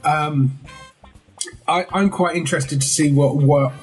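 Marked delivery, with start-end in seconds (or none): none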